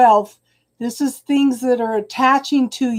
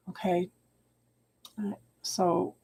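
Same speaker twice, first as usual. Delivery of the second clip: ordinary delivery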